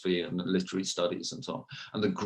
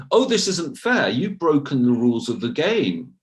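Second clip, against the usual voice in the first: mock protest, pitched up and louder; indignant protest; 5 semitones higher, 5 dB louder than usual. Critics complaining to Professor Sokal about his hoax, mock indignant protest. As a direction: imitate aggrieved objectors crying foul.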